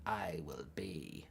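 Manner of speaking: very soft, low, slow croak; hushed, drawn-out dark lord impression; Palpatine. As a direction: intone each phrase low with long pauses. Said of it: "I can do it like this".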